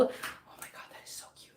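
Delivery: hushed, excited aside whisper. Real, not acted. Owner whispering to viewers about a cute kitten, hushed. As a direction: whisper so the kitten isn't disturbed.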